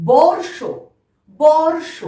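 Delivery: teacher modelling বর্ষ with a clear র; model pronunciation. Shown as a demonstration. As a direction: sound র clearly before ষ in বর্ষ. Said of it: "তাহলে কিভাবে বলবো".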